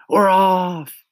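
high, loud cheer; crowd cry. A narrator's reading. The others echoing the centipede, high loud cry. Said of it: "the others cried".

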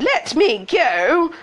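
high, loud, drawn-out yell; slow high-pitched shout; shouted protest. A narrator's reading. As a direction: yell to be released, stretching the words. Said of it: "As Cindy shouted".

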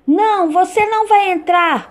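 little pig voice. Acted. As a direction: pipe up high like a piglet. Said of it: "os porquinhos responderam".

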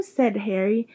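plain reading voice

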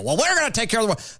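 dismissive send-up of a politician; mocking imitation. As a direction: rattle it off as a naive pledge. Mimicking the president's promise.

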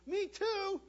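put-on very high squeaky little kid; squeaky high-pitched voice. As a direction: squeak it like a tiny sibling. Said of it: "another squeaky, high-pitched voice".

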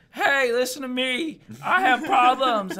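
loud, slow, high plea; drawn-out hammed-up announcing; mock stage holler. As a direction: beg the crowd loudly for attention. Comic imitates own desperate stage plea.

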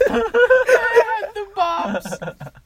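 laughing squeal. Acted.

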